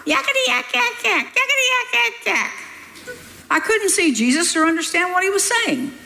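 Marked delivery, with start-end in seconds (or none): 0.1-2.5 high, squeaky demon chatter, much higher than her own voice; little demon jabbering. Retold or imitated. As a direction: jabber like a tiny mocking demon. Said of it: "crying, 'Yakety yak".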